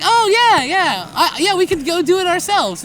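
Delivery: mock eager go-getter; excited. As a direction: jump at the idea.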